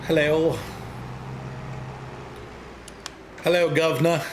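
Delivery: hammed-up English "guvnor" greeting; mock English accent. Husband showing off his English accent to tease, mock English. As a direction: put on a cartoonish English accent. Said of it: "do your English accent".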